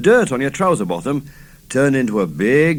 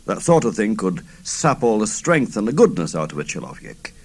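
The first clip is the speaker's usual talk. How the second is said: ordinary delivery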